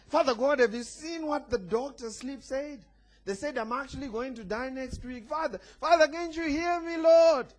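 high-pitched parody of a pleading believer; mock desperate prayer. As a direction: plead with God in hammed-up panic, building to a cry. Mimics overlong panicked prayer in storm.

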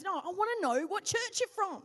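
won't let it go; insistent radiographer. Retold or imitated.

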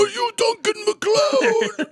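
put-on high, shouted crazy old man. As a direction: yell it like a frantic old man. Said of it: "a real crazy old man voice".

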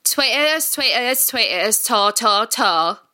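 Essex accent. Shown as a demonstration. Guest gives first mystery accent for hosts to guess, Essex accent. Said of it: "maybe Essex".

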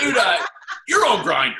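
mock accusation. Teasing accusation back at friend.

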